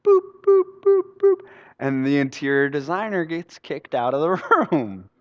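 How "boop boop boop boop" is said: short, high, even electronic boops; imitated monitor beeps. Dad's heart brought back, evenly imitated monitor beeps. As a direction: mimic a heart monitor beeping steadily. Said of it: "They bring him back".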